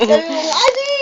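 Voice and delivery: put-on playful, squeaky coaxing for a baby to copy. Shown as a demonstration. Coaxing baby to repeat a word.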